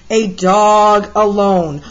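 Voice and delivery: ordinary delivery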